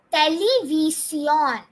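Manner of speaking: television drawn out as te-le-vee-see-ON; slow Spanish model. Shown as a demonstration. Teacher leading the second repeat, slow te-le-vee-see-ON. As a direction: stretch each syllable of television, te-le-vee-see-ON. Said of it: "The second time".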